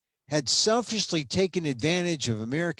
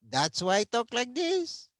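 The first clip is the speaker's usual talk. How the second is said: hammed-up Filipino accent, pitched even higher; an overdone, comic Filipino accent; exaggerated Filipino accent; 10 semitones higher than usual. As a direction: push the mock Filipino accent further. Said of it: "with a bad Filipino accent".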